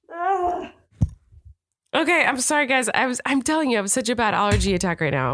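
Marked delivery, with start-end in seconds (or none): 0.1-0.7 sneezing. Real, not acted. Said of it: "Oh, sorry for my sneezing".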